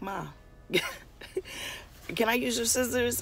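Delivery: high, bright plea for scissors; pleading with mom. Retold or imitated. Daughter asking mom for scissors, high eager plea. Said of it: "and I'm like".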